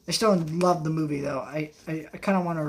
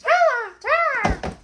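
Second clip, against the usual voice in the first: imitated high, loud toddler cry; toddler wail; 7 dB louder than usual. Parent imitates toddlers interrupting the movie, high-pitched wail. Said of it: "toddlers, they wanna interrupt you constantly".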